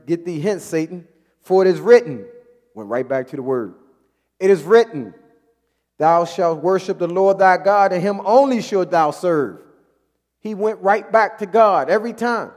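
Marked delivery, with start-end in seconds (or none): 0.1-9.6 loud, ringing scripture reading; scripture read with force. Acted. Preacher reading Jesus rebuking Satan, loud and ringing. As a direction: proclaim each verse like a rebuke.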